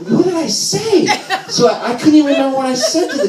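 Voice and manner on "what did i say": high, stretched-out mock bafflement; incredulous. Son baffled by mom's podcast complaint, high incredulous question. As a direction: ask it way up high, slowly.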